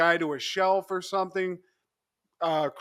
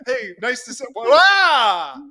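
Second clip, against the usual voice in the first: greeting that breaks into a loud startled yell; mock-startled shout mid-hello; 6 semitones higher, 10 dB louder, slower than usual. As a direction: cut yourself off with a loud shriek.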